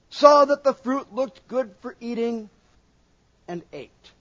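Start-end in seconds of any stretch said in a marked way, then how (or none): none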